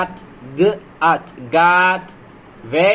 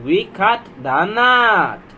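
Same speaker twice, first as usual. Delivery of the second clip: very slow, word by word; slow word-by-word read; 2 semitones higher, much slower than usual. Shown as a demonstration. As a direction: read it out slowly for beginners. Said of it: "slow sentence reading demo".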